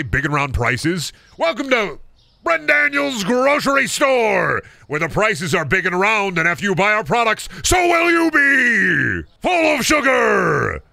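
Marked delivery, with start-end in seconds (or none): 1.4-10.8 high, drawn-out advert bellow; hammed-up store announcer. Owner welcomes shoppers to his grocery store, hammed-up announcer. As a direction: belt out a silly shop slogan, stretching the final words.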